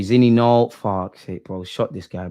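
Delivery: ordinary talk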